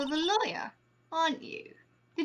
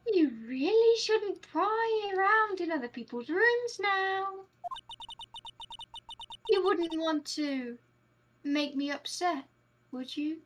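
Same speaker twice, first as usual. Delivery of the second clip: slow, sweetly threatening character voice; coy menacing witness; 6 semitones higher, slower than usual. Acted. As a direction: draw out a veiled warning as the witness. Voices game's witness giving warning.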